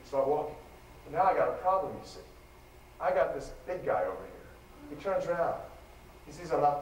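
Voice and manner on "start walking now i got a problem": ordinary talk, nothing marked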